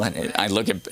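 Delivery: ordinary talk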